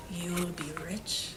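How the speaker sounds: hushed, breathy secret; secret whisper. Acted. Teacher whispering the secret of gold to the class.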